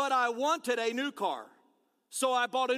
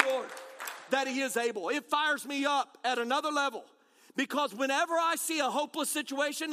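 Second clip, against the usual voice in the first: ordinary delivery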